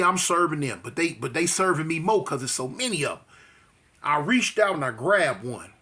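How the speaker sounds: usual speaking voice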